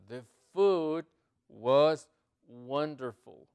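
loud and very slow, word by word; slow model reading. Shown as a demonstration. Teacher presenting a new English example, loud and slow. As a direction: project each word separately.

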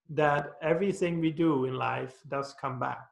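ordinary talk, nothing marked

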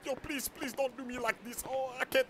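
high, strained, soft gasping pleas; high choking voice; choked friend. Acted. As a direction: beg while being strangled. Crypto being choked.

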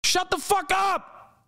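high, slow screamed outburst; furious shout. Real, not acted. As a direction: yell it at the top of your lungs. Exploding at the pundits.

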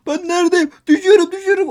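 high, loud panicked cry; panicked falling. Real, not acted. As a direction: yelp it out while falling. Gamer panics while falling.

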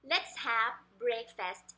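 slow "Let's have breakfast", each word set apart. Shown as a demonstration. Teacher models the sentence for parents, word by word. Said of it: "เราเอาจังหวะมา".

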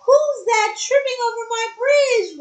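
high-pitched troll roar. A narrator's reading. Troll challenges the second goat, in a high roar. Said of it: "roared the troll".

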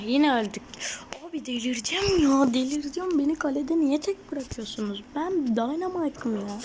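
about to lose it at teammates; loud whining at his teammates; frustrated outburst. Real, not acted. Team keeps dying, he complains.

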